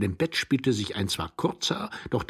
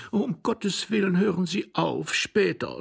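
higher-pitched wifely impatience; exasperated plea; 10 semitones higher, slower than usual. A narrator's reading. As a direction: beg the husband to stop, pitched up. Wife begs husband to stop.

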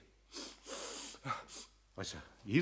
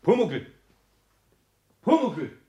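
louder call across the workshop; 5 semitones higher, 6 dB louder than usual. Acted. Eder calling for Pumuckl from another room. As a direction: call him from the next room.

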